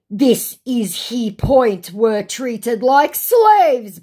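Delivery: slow, loud, high mock wail; hammed-up labouring indignation; outraged scammer. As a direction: draw out the scammer's protest loudly.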